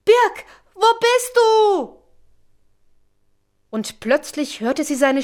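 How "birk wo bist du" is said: high, drawn-out cry; desperate calling. A narrator's reading. Ronja cannot find Birk, calling out desperately. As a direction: call slowly into the distance in distress. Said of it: "schrie sie in ihrer Not".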